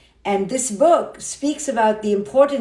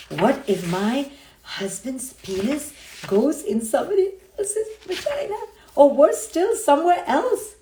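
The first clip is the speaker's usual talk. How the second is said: hammed-up fearful wife, climbing high; exaggerated high-pitched dread, played for laughs; mock horror; 4 semitones higher than usual. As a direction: act scandalised, voice rising into laughter. Voices jealous wife's fear.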